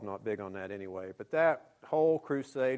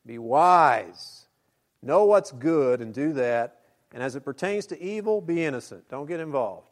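preaching; 3 semitones higher, 10 dB louder than usual. Acted. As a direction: urge the flock firmly. Forceful preaching.

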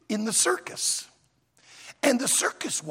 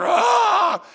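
loud, high imitated roar; mock lion roar; 11 semitones higher, 9 dB louder than usual. Lions roaring at the fallen gorilla, loud mock roar. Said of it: "the lions start roaring".

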